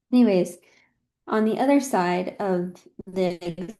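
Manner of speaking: ordinary delivery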